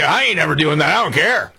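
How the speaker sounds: mock defiance, pitched high; high-pitched dismissive impression of a co-host; mock defiant. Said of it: "You were like".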